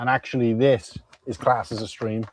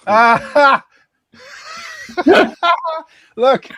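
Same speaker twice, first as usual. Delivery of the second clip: high, loud laughing surprise; surprised shriek; 8 dB louder than usual. Real, not acted. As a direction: shriek with delight when he appears. Ken appears on cue.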